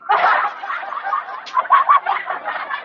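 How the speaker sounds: silent high wheezing laugh; high breathless laugh. Real, not acted. Laughing at his own conservative impression, high wheeze.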